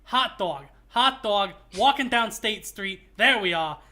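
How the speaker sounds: loud, hammed-up Chicago accent; loud Chicago accent getting into character; thick Chicago accent. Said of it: "I've gotta get into the character".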